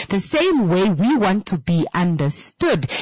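ordinary talk

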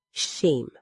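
ordinary delivery

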